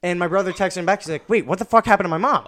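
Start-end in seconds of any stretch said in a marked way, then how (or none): 1.3-2.5 brother's alarmed question. Retold or imitated. Brother's alarmed text acted out.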